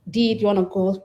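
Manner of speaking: ordinary talk, nothing marked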